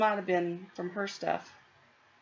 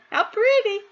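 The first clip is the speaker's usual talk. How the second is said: delighted; 9 dB louder than usual. Real, not acted. Admiring own stitching, delighted.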